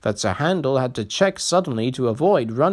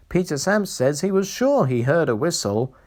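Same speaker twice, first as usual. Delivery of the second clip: usual speaking voice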